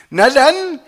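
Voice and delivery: high, gleeful crowing; jubilant. A narrator's reading. Laura celebrates getting the extra food, jubilant. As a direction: rejoice in a high, loud voice. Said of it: "jubilierte Laura".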